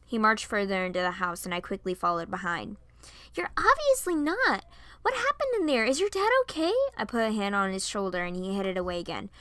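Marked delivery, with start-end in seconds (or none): 3.3-6.7 high, insistent concern; worried questioning. A narrator's reading. Piper asks Varian what happened, worried. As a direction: press him with louder, higher questions. Worried friend presses him.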